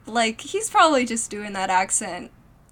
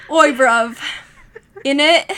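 mock British accent; 6 dB louder than usual. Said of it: "my British accent".

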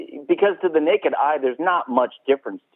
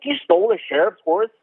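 retold disbelief; 7 semitones higher than usual. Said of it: "and they're like".

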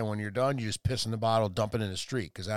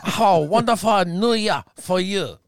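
put-on higher, louder caricatured Chinese accent; mock Chinese accent; 5 semitones higher, 5 dB louder, slower than usual. Answering a request for an accent, mock Chinese accent. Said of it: "can you do a Chinese accent?".

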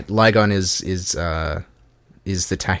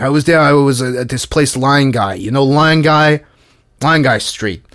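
higher, louder New York tout impression; tout's voice; 6 semitones higher, 7 dB louder than usual. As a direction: ham up the pushy New York street tout. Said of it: "Can't do the voice without coughing".

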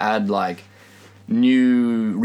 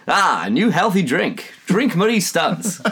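put-on raised, slightly stretched old-time sales pitch; old-timey advertiser; 5 semitones higher than usual. Friend pitching the beer as an old health tonic, old-timey announcer. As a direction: sell it like an old-timey ad man. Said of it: "Dude, you sound old-timey".

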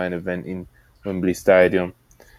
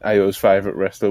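ordinary talk, nothing marked

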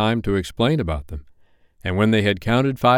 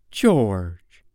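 pained exclamation. A narrator's reading. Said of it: "Missus Darling exclaimed, pained".

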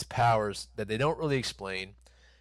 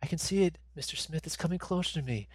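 put-on lightly higher, small boy's voice; childlike boy voice; 7 semitones higher than usual. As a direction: play the frail dying boy reaching out. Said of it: "He's like".

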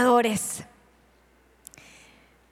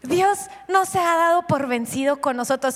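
ordinary delivery

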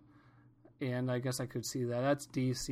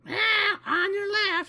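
much higher and louder mock warning from a rich old lady; mock shrill cyclist; 6 dB louder, slower than usual. Runner imitating the lady who clipped him, loud high mock cry. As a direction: squawk it like a startled old cyclist. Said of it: "she just goes".

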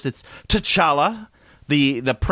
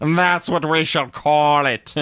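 put-on slow, pompous British accent; 3 semitones higher, slower than usual. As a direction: stretch it out like a stuffy British explorer. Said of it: "some British guy go".

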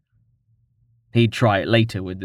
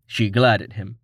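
plain reading voice

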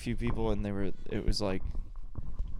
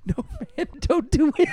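laughing through it; 4 dB louder than usual. Retold or imitated. Laughing while quoting own reply.

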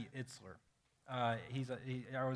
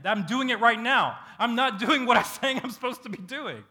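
louder and higher, laughing at yourself; startled realization; 8 semitones higher, 11 dB louder than usual. Retold or imitated. Preacher realizing he is failing at his own message, laughing. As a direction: catch yourself mid-mistake, amazed.

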